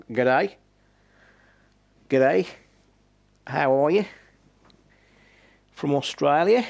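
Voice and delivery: badly faked Aussie greeting, lilting up at the ends; butchered Australian accent. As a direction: put on a hammed-up Australian accent. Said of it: "Butchering the accent".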